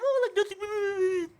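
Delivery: put-on oblivious-friend voice; mocking impression of friend. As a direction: mimic him as clueless and carefree. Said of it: "and Pierre's just like".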